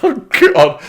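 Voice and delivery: high, loud cry of dismay; exasperated outburst. Real, not acted. Viewer reacting to a character's awful voice, exasperated.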